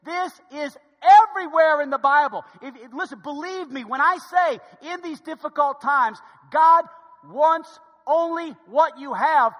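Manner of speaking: high, loud, driving sermon; impassioned preaching. Real, not acted. Preacher urging church that God uses what they have, impassioned. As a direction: preach it out forcefully, punching key words.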